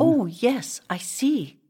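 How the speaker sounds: courteous little reply; polite. A narrator's reading. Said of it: "Emily said politely".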